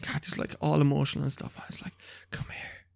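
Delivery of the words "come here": softly whispered plea to come closer; dying man whispering to wife; deathbed whisper. Retold or imitated.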